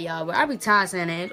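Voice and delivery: ordinary delivery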